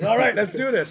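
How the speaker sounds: high, loud mock eagerness; happy, eager. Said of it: "And the guy's all happy".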